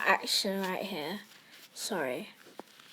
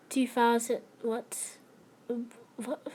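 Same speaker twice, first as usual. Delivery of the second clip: ordinary talk, nothing marked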